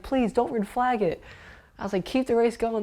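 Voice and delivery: imitating an urgent appeal; retold plea. Retold pleading with race officials.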